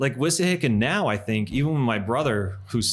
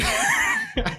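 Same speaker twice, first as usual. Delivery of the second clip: high squealing laugh. Real, not acted. Laughing at his own skit.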